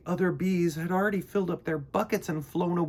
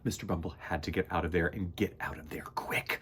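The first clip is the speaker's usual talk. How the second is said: unvoiced, softer, fast whisper; scared whisper; 6 semitones lower, 11 dB quieter than usual. A narrator's reading. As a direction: hurry the words in a hush. Scary moment whispered.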